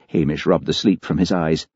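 ordinary delivery

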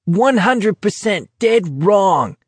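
high, slow, drawn-out jeer; taunting yell. Acted. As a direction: stretch each word to mock him. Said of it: "Barkovich yelled".

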